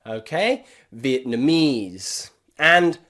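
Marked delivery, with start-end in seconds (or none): 0.9-2.3 slow "Vietnamese" with long ee in the last syllable; long E pronunciation. Shown as a demonstration. Teacher modelling words for practice, slow long E ending. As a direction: draw out "Vietnamese", ending in a clear eez. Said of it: "why we pronounce these E".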